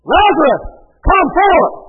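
high, loud commanding call; loud cry. Acted. Jesus calls Lazarus out of the tomb, loud cry. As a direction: cry it out as an order to the dead. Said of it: "he cried out with a loud voice".

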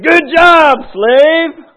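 high, loud praise; hearty master. Acted. Master praises the faithful servant, high loud hearty praise. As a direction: boom out approval to the servant. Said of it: "Master said".

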